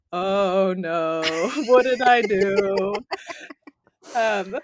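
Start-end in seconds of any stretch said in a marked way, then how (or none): 0.1-3.0 mock dismay. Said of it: "being like".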